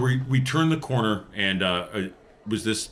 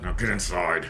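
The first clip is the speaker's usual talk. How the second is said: growl; 3 semitones lower than usual. Acted. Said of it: "and I growl at him".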